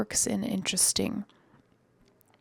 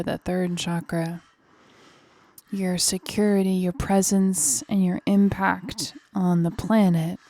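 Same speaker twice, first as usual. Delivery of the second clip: coaching over effort; 6 dB louder than usual. Real, not acted. Pushing class through hard squat.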